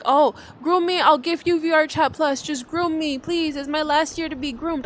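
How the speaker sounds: mocking teen plea. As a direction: ham up the teasing kids begging. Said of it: "they even were like".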